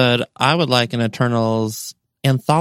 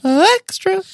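high echo voice. Acted. Echoing show name in funny voice.

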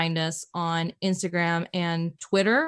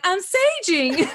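slow, much higher sing-song innocence; slow high mock-innocent; 12 semitones higher, 4 dB louder, much slower than usual. Telling her puzzled husband she's saging, slow high innocent. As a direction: play it sweetly innocent, drawn out. Her matter-of-fact reply retold.

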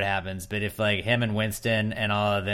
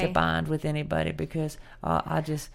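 imitating Sam; 6 semitones higher, 6 dB quieter than usual. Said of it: "she's the sympathetic robot girl now".

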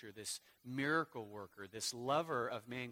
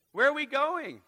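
Where are we going called out much higher and louder; fiery preacher shout; shouted question; 12 semitones higher, 14 dB louder than usual. Acted. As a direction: throw the question at the crowd.

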